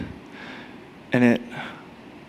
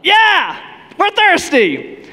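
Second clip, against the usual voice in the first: whine-yell it at him; shout; much slower than usual. Retold or imitated. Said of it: "somebody else shouts out".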